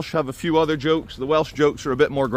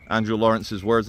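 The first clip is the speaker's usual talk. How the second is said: normal talking voice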